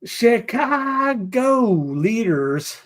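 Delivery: very slow, high, stretched CHICAGO; drawn-out headline. Pretend. Host opens a Chicago headline, playfully drawn out.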